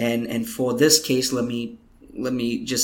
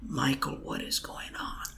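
whisper; 8 dB quieter than usual. Retold or imitated. Helen asks, stunned, in whisper.

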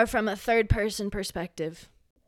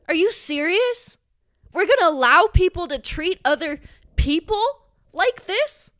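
indignant outburst; 6 semitones higher, 4 dB louder than usual. Real, not acted. Outraged rant at abusive studio.

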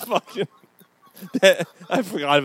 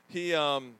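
ordinary talk

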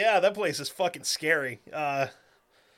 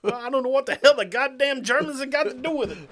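sheriff voice; 7 semitones higher, 4 dB louder than usual. Retold or imitated. Quoting Sheriff Justice.

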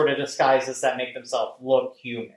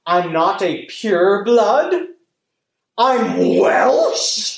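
slow, hammed-up appalled realization, rising higher and louder; mock horror; 4 semitones higher, 4 dB louder, much slower than usual. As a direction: play aghast disbelief for laughs, swelling on the last word. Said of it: "that was the greatest horror".

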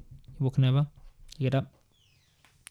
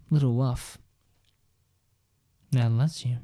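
ordinary delivery

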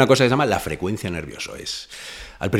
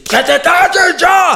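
much higher and louder, slow, furious shout; angry yell; 8 dB louder, much slower than usual. Retold or imitated. Tired bandmate shouting at him to shut up. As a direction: bellow it out of patience.

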